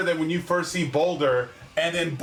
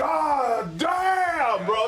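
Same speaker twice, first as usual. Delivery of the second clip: awed exclamation; 6 semitones higher, much slower than usual. Retold or imitated. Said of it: "You're like".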